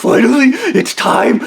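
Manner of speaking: high screaming villain with cackles; hammed-up unhinged screaming gunman; maniacal threat. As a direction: shriek the threat like a madman. Stu with gun screaming.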